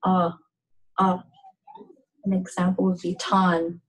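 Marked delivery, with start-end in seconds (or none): 0.0-1.2 Uh said as the open vowel in call; an isolated open ah for Uh, slightly long; long A sound. Shown as a demonstration. Teacher showing the letter A, Uh opened like call. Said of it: "in the English word call".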